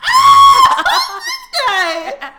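high, loud shriek of laughter; cracking up through the words; laughing hard. Real, not acted. Watching a stranger scream in Penn Station, dying laughing. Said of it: "fucking dying laughing".

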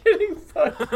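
high, loud laughing repeat; laughing echo. Real, not acted. Echoing a friend's crude joke, cracking up.

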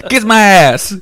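loud, high-pitched mock outburst; comic yell. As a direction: yell it out for a laugh. Comic outburst after mummy voice bit.

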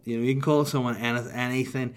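usual speaking voice

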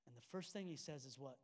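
ordinary talk

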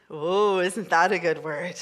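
ordinary delivery

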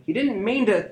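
high, pleading cry; fearful plea. A narrator's reading. Sister pleading for her brother, frightened. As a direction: beg desperately. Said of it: "Nella called out, frozen with fear".

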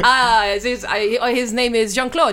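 big theatrical French welcome with a laugh; French waiter. Pretend. Waiter introducing Jean-Claude the sommelier, loud French accent.

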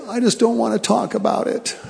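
put-on high, small child's voice, sulking; childlike voice. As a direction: speak it like a little boy who won't talk. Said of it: "in a childlike voice".

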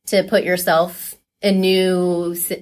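usual speaking voice

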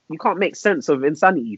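ordinary talk

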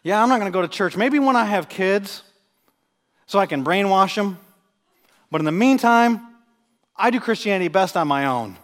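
imitating a blunt refusal, high and loud; retold builder's brush-off. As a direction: quote him loudly, in spaced-out phrases. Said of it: "He said".

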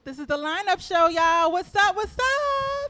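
loud, high hype intro; show host hype. Real, not acted. Host greeting listeners, big hyped-up welcome. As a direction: pump up the listeners. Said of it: "hosts opening the show".

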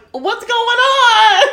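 high, loud, drawn-out imitation; mock alarmed wail. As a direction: wail it slowly like a distraught friend. Friend outside reacting, retold.

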